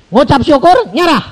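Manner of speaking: high, loud urging. Acted. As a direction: urge her to give it up. Urging sick wife loudly. (desakan keras dan tinggi)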